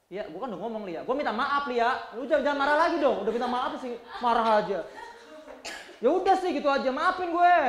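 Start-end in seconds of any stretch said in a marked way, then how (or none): 1.0-7.6 loud, impatient, high half-laughing apology; pushy apology. Retold or imitated. Man loudly, impatiently apologising to Lia while still picking a fight. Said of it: "Maaf tapi ngajakin berantem lagi".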